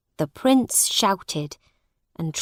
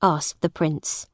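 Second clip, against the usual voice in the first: ordinary delivery, nothing marked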